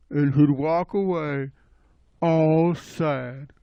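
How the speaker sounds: soft, sorrowful narration; sad. A narrator's reading. Storyteller on poor Stinky leaving, softly sad. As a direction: let it drop softly in pity. Narrating the rejected skunk's sadness.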